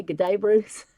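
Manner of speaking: playful mock Australian greeting; Australian accent. Streamer who loves accents, showing off her favourite phrase, mock Australian accent. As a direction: toss it out in a jokey Aussie accent. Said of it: "I just love to say that".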